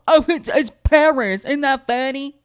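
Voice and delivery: high, loud sneering put-on voice; mocking impression of Texans. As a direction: mimic them loudly in a silly high voice. Mocks Texans who named the town.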